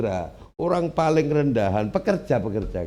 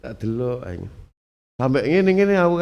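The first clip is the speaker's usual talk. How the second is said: ordinary delivery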